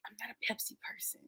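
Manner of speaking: soft breathless whisper; whispered aside. Real, not acted. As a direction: confide it under your breath. Aside admitting a preference.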